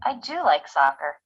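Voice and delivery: I do like soccer with do stretched and stressed. Shown as a demonstration. Correcting a friend about soccer, stress on do. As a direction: lean hard on do. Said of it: "for emphasis".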